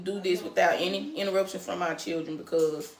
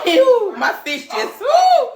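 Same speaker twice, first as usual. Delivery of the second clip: excited squeal; 14 semitones higher, 9 dB louder, much slower than usual. Real, not acted. Laughing outburst on live stream.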